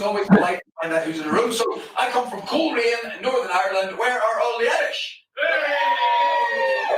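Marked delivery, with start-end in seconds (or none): 5.4-7.0 cheering hey. Real, not acted. Crowd call-and-response cheer.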